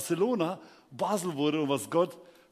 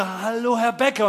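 louder, higher starstruck hail; eager greeting; 5 semitones higher, 7 dB louder, slower than usual. Retold or imitated. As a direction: call out hopefully to a celebrity. Eagerly hailing a celebrity. (freudig lauter Zuruf)

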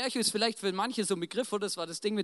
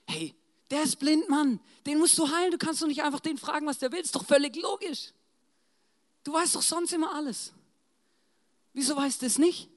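high-pitched, incredulous protest; exasperated reproach; 7 semitones higher than usual. Acted. Disciples question Jesus over the blind man, exasperated. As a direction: argue with disbelief at an absurd question.

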